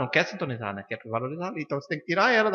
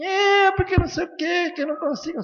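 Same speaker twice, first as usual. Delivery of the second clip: whiny complainer; 5 dB louder than usual. Retold or imitated. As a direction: imitate the patient's helpless excuses, pitched up. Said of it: "Aí um dia chegou lá assim".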